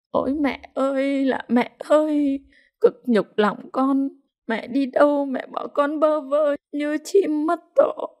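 pitiful wailing. A narrator's reading. Widow mourns her dead mother-in-law, wailing pitifully. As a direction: wail it like a mourner at the coffin. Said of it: "tiếng khóc thảm thiết".

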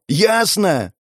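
high, forceful yelled demand; yelling. Acted. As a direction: yell it to force an answer. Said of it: "заорал я".

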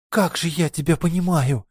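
high, eager exclamation; excited agreement. Acted. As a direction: burst out in delight. Astaopa's eager reply.

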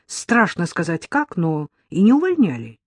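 usual speaking voice

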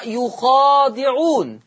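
«يخادعون» with damma on the ya and a long alif after the kha, very slow; Warsh reading. Shown as a demonstration. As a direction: recite «يخادعون» as Warsh does, stretched out. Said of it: "يضم الياء ويفتح الخاء". (قراءة «يخادعون» برواية ورش ببطء شديد)

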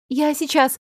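lightly flustered murmur; flustered mumble. A narrator's reading. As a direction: blurt it awkwardly before leaving. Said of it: "пробормотала я".

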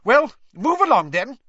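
high, loud and sharp. Acted. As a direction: snap at the wet crowd. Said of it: "said Professor McGonagall sharply".

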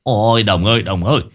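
hớt hải kêu lên, cao giọng, lắp bắp; báo tin xe bị cẩu cho đồng già; panicked shout. A narrator's reading. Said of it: "hớt hải ... kêu lên". (hốt hoảng gọi toáng lên)

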